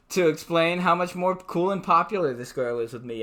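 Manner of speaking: ordinary delivery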